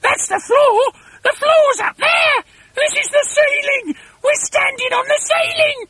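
very high, loud shrieking; panicked scream. A narrator's reading. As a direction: screech each realisation in horror. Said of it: "screamed Missus Twit".

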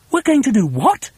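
put-on high-pitched incredulous child; astonished cry. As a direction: cry out in disbelief, rising at the end. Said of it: "they cried".

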